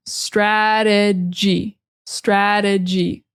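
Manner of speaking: "Strategy" very slow, syllable by syllable. Shown as a demonstration. Teacher breaking down the word, slow stra-duh-gee. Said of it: "T sounds more like a D".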